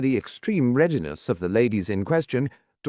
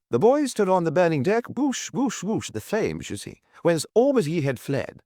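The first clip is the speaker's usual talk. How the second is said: recited with action; 9 semitones higher than usual. Shown as a demonstration. Anthony clowning for Miss Taylor, hammed-up recitation. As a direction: act it out like a schoolboy showing off. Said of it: "I can do that bit with action".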